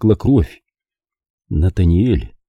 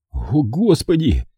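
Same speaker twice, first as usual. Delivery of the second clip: slow, high moan of dismay; drawn-out, anguished exclamation; 6 semitones higher, slower than usual. A narrator's reading. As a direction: moan it out in horror. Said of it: "простонал".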